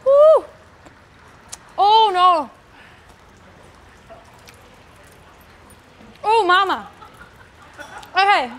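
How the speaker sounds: whooping through a burning mouth; mouth burning from spicy food. Real, not acted. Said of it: "that's so spicy".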